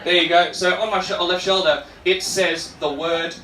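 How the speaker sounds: ordinary talk, nothing marked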